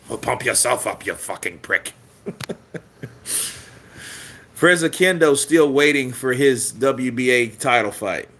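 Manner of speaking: ordinary delivery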